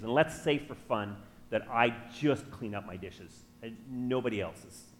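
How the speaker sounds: usual speaking voice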